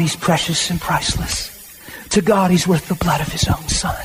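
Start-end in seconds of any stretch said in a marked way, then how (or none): none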